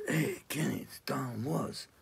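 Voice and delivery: whispered, slow phone hello; breathy hushed introduction over the phone; hushed phone intro. Retold or imitated. Producer calling the drummer, in a slow hushed whisper. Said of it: "So he calls me up and goes".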